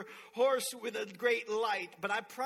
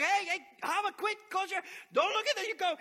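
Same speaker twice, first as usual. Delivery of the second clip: high, loud, hurried warning; dad scolding his daughter in the car; urgent parent warning; 10 semitones higher, 7 dB louder than usual. Retold or imitated. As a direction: call to the kid in a panic.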